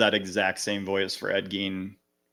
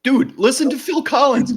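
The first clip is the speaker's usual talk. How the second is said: raised, high insistent push; emphatic shout; 13 semitones higher, 7 dB louder than usual. Real, not acted. To a friend dismissing [name], loud insistence. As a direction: call out the name hard. Arguing about drummers.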